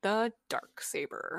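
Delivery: slow, drawn-out awe; dramatic reveal. Acted. Echoing the co-host's big weapon reveal, slow and dramatic. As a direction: draw out each word with weight.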